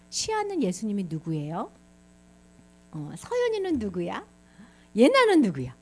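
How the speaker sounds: usual speaking voice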